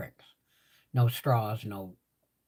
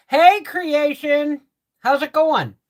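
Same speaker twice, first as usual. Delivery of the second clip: cheery greeting; 13 semitones higher, 7 dB louder, slower than usual. Real, not acted.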